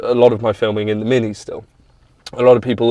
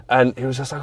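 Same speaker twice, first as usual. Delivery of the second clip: ordinary talk, nothing marked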